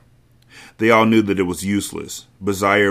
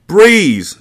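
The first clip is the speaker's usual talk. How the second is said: high, loud desperate yell; 11 semitones higher, 8 dB louder than usual. A narrator's reading. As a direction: scream her name through the door. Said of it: "Zaire yelled again".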